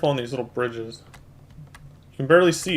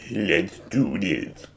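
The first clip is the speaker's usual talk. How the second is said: low, slow, dramatic hero voice; deep dramatic voice; 6 semitones lower, much slower than usual. Pretend. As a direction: growl it out like an action hero, slowly. Repeats phrase in put-on low voice.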